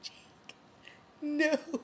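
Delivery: breathy, whispered plea to a dying Jake; anguished whisper. Retold or imitated. Fan pleading with the stabbed hero, whispered despair. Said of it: "It's like".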